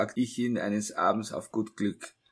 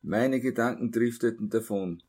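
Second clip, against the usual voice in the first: plain reading voice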